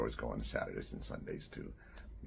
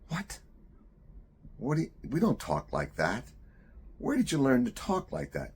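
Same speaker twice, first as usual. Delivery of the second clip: high, louder scandalized scolding; shocked mother; 11 semitones higher, 6 dB louder than usual. Retold or imitated. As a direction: hush the child, horrified in public.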